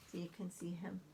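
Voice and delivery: ordinary delivery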